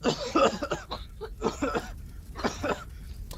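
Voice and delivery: rough smoky coughing fit; coughing in the burning hall; choking cough. Acted. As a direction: choke on the smoke. Choking smoke.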